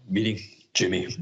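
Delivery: normal talking voice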